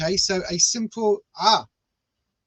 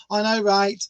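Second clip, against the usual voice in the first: ordinary delivery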